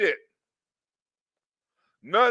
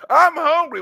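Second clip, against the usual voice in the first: mock hungry passenger; 5 semitones higher, 6 dB louder than usual. Mocking the hungry train passenger.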